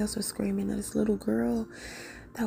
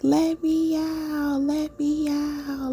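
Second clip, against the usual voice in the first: put-on high, slow, louder child's voice begging; slow, pleading child's cry, pitched up; trapped child calling; 5 semitones higher, 3 dB louder, much slower than usual. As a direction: drag out each plea like a child shut behind a door. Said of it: "I'm just hearing somebody like".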